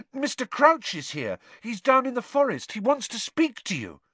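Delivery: high, pressing report; insistent, high-voiced young hero; Harry, urgent. A narrator's reading. Harry urgently telling Dumbledore.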